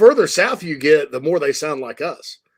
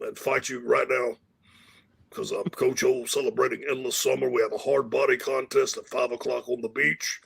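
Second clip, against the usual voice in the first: softer, rough-voiced Coach Orgeron imitation; Coach O impression; 4 dB quieter than usual. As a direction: ham it up like a cajun football coach on vacation. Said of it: "give him your Coach Orgeron impression".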